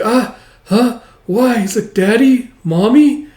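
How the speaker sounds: put-on high, shocked child calling for her parents; young girl calling her dead parents, high and frightened. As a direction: call out to Daddy and Mommy in alarm.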